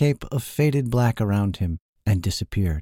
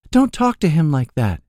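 higher-pitched woman's voice, scolding; reverent enchantress; 6 semitones higher, 4 dB louder than usual. A narrator's reading. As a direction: chide them as a lofty enchantress. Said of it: "she intoned with reverence".

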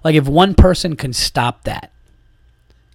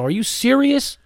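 incredulous; 10 semitones higher than usual. Real, not acted. Disbelief at bartender's reaction.

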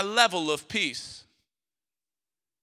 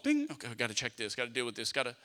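quick, low, softer self-talk; distracted hurry; 7 semitones lower, 9 dB quieter, faster than usual. Preacher acting out a text interruption, hurried distracted muttering. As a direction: rattle it off under your breath. Acts out being interrupted by texts.

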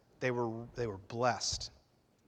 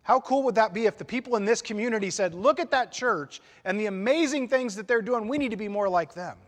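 fervent preaching; 7 semitones higher, 6 dB louder than usual. Real, not acted. Preaching with rising force.

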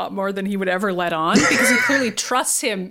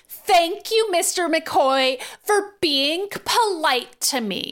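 prissy Emma; 5 semitones higher than usual. Retold or imitated. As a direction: drag it out like a snooty lady. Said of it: "enough for Emma to be like".

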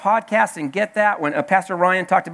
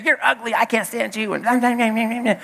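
higher-pitched mock parrot rant; mild parrot nagging, pitched up; lightly voiced scolding parrot; 4 semitones higher than usual. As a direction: rattle off a parrot's insults, lightly acted. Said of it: "parrot inside the freezer still say".

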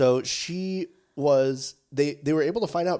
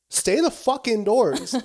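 emphatic warning; 7 semitones higher, 6 dB louder, slower than usual. Real, not acted. Warning listeners to stay home.

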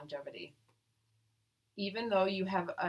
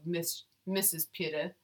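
ordinary delivery

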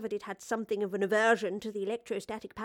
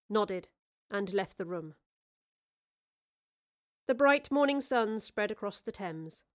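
usual speaking voice

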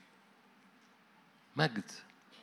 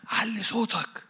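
much higher, mock stage call; quoted instruction; 11 semitones higher, slower than usual. Preacher mimicking homiletics advice, high mock command. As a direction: play the preaching manual barking its rule. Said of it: "علم الوعظ يقول لك".

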